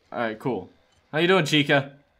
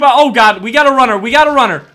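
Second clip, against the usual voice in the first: sudden high, loud alarm; alarmed shout; 6 semitones higher, 11 dB louder, faster than usual. Real, not acted. Player spotting a runner coming, alarmed shout. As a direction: call out the sudden threat.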